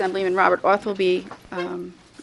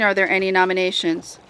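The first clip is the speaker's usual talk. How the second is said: ordinary talk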